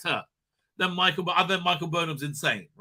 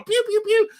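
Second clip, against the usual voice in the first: high mock blaster noises; playful pew pew effect; laser pew sounds; 5 dB louder than usual. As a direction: zap like a toy ray gun. Imitating laser gunfire.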